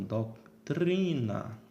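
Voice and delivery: "doutrina" slowly, with a long, stressed i; tônica na penúltima. Shown as a demonstration. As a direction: linger on TRI in "doutrina". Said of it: "Temos um I longo aqui". ("doutrina" devagar, com o i longo e tônico)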